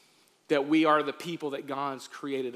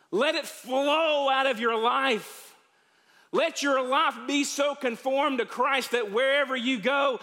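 preaching at full force; 8 semitones higher than usual. Acted. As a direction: urge the congregation to live it. Closing exhortation at full force.

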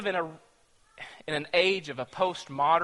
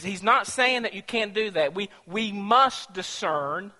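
normal talking voice

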